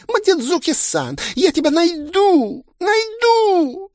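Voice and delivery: shrill, very high obsessive yell; threatening shout. A narrator's reading. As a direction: scream it like a clingy stalker. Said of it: "раздалось из-за дверей". (истеричный угрожающий крик)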